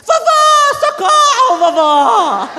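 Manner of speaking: put-on high shrieking child crying for grandma; child calling for help. Mother imitates daughter shrieking to grandma for help.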